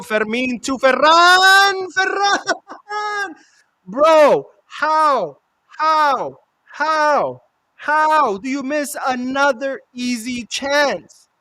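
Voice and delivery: high, loud shouting at a miss; excited yell. Real, not acted. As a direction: schreeuwt vol ongeloof. Missed chance live.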